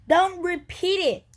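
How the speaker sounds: high, loud irritated snap; angry snap. Acted. Cat great-grandpa snapping angrily at the grandson. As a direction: bite the words off sharply. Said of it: "snapped Great-Grandpa Julius angrily".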